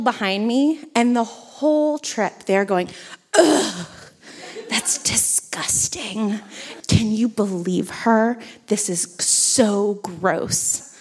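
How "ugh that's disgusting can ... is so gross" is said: imitated revulsion, slow and drawn out; gossipy disgust, imitating snooty onlookers; mock disgust. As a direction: drag out the key words in mock revulsion. Disgusted couple behind her, imitated.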